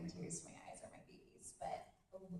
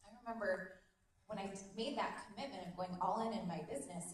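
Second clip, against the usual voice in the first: usual speaking voice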